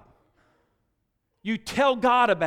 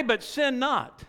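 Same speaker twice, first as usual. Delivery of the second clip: usual speaking voice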